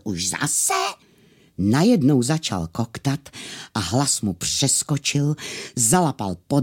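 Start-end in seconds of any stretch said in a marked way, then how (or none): 0.0-0.7 restrained echo of an angry squawk; angry cry, understated. Acted. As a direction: recall the tailor's indignant cry, mildly. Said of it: "Jak předtím zlostně křičel".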